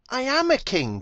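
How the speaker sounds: high, loud protest; little donkey protesting to the bully, crying out high and loud. A narrator's reading. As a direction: cry out in desperate insistence. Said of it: "cried Little Donkey".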